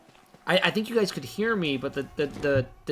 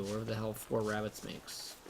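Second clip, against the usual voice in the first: ordinary delivery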